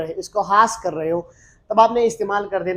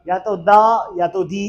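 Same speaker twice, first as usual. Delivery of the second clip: ordinary talk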